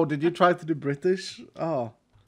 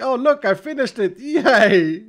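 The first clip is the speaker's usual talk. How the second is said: higher, louder hammed-up proud announcement; mock showing off; 7 semitones higher, 7 dB louder, slower than usual. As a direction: crow it with a laugh, mock triumph. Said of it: "Just shown this off as like".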